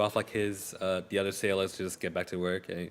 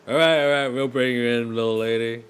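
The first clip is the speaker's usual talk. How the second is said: louder, higher chuckling reply; amused sailor; 3 semitones higher, 10 dB louder than usual. Acted. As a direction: humor her like a rough sailor.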